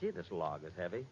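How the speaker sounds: ordinary talk, nothing marked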